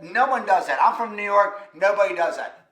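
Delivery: loud, insistent New Yorker retort. Retold or imitated. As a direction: play a disbelieving man from New York. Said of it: "So he said".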